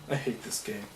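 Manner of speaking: very soft, low mutter; muttered grumble. Retold or imitated. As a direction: grumble it under your breath, low. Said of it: "you'll frequently hear me go".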